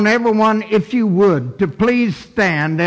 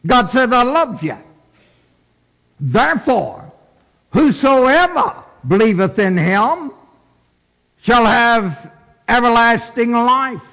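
raised, emphatic preacher's voice; preaching; 6 semitones higher than usual. Acted. Preacher gives God's promise of everlasting life, emphatic preaching. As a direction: declare it high, with weighty pauses.

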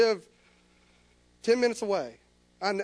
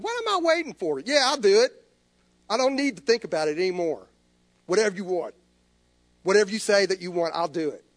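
raised, urgent yielding; fervent surrender; 3 semitones higher, 7 dB louder, faster than usual. Retold or imitated. Preacher telling God he will go, fervent. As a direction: declare it with rising fervour.

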